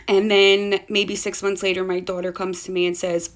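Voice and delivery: ordinary talk, nothing marked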